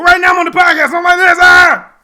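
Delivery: loud, high hype shout; hyped shouting. Real, not acted. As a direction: yell it, fully amped. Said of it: "I'll be more like this".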